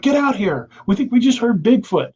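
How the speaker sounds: high-pitched urgent call; imitating excited friends. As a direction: call your buddy over, all worked up. Retold excited friends calling him out.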